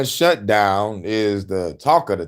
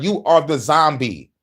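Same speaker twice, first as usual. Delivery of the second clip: usual speaking voice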